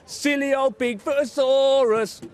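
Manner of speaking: high, loud teasing taunt; mocking cry. A narrator's reading. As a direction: jeer at the snowman in a high voice. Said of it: "cried Tiny's friends".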